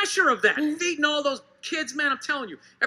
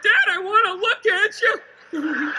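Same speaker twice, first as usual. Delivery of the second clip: put-on kid's plea; 6 semitones higher, 4 dB louder, slower than usual. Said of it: "you're like".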